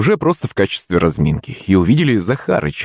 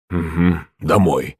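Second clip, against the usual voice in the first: low, slow, sour agreement; wry grimace; 5 semitones lower, slower than usual. A narrator's reading. Slapped servant is ordered home, sour grudging reply. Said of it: "скривился Захарыч".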